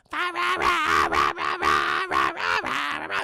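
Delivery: raucous creature-like rah-rahs; imitated gremlin caroling. Gremlin carolers outside the cat lady's house, mock raucous singing. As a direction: chant nonsense rahs in a put-on creature voice. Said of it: "all of a sudden, she hears this".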